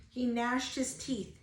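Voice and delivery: ordinary delivery, nothing marked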